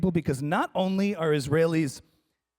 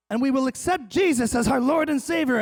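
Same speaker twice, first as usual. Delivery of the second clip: higher, lightly mocked religious fervor; hint of Southern evangelical; 6 semitones higher than usual. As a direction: proclaim it like an eager believer. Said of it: "these were, like, Southern evangelicals".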